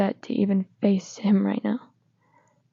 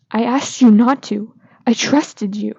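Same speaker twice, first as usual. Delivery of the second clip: loud, angry reproach; angry shout of broken trust; 7 dB louder than usual. Acted. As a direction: hit him with the betrayal, loud. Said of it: "loud and in an angry tone".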